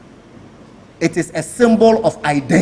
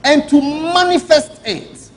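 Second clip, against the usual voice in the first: "manifest" drawn out slowly and pitched up high; stress on manifest; 7 semitones higher, 3 dB louder, slower than usual. Shown as a demonstration. Minister to the cameras, slowly stressing "manifest". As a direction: lean hard and slow into "manifest". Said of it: "I want to emphasize the word manifest".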